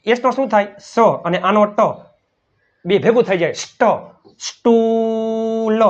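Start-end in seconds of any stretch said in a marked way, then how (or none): 4.4-5.8 very slow, each long ઊ stretched out; slow teacher demo of the double-o sound; drawn-out phonics words. Shown as a demonstration. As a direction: stretch every word out like a phonics drill. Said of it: "S P એટલે સ્પ".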